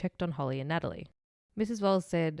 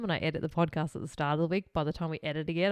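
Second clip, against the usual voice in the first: ordinary talk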